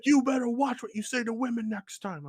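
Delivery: high-pitched warning voice; retold security guard. Security guy warning the customer, high scolding imitation. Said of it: "the security guy walks out".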